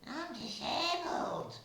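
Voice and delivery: hammed-up high cry; shrill protest. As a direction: squeal it in mock distress. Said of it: "Or you actually said".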